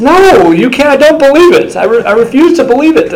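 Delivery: high, loud squeal of disbelief; a shocked owner who can't take it in; stunned disbelief. Retold or imitated. Watch owner hearing the price, squealing in disbelief. Retold owner refusing to believe.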